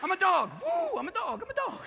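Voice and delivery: high-pitched fake dog voice; yippy, high mock dog; coyote posing as dog. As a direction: pretend excitedly to be a dog.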